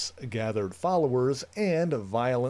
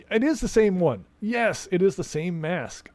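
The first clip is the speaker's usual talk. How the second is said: excited recognition; 7 semitones higher, 5 dB louder than usual. Real, not acted. Recognizing the mask in game.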